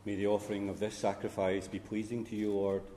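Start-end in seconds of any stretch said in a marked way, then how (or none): none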